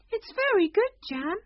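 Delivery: high and loud, insisting; a queen defending her jam. Acted.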